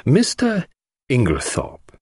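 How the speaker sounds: high first word, dropping on the surname; single him out by name, deliberately; Poirot, direct address. A narrator's reading. Poirot turns on the suspected husband, pointed and grave. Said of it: "addressing him directly".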